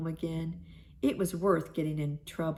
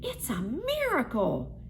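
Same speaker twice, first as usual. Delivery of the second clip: Mama Hyena exclaims over the sudden plenty of food; delighted exclaiming; slower than usual. A narrator's reading. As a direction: squeal with joy at the feast. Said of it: "exclaimed Mama".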